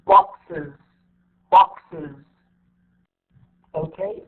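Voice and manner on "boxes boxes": "boxes" loud and falling, box-iz; extra IZ syllable. Shown as a demonstration. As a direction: say "boxes" with an iz ending. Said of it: "إي زد".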